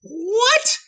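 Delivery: ordinary talk, nothing marked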